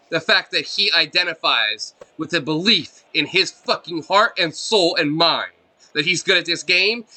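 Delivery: heated, high and loud; angry rant. Real, not acted. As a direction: tear into them, voice rising. Ranting about tearing down cheaters.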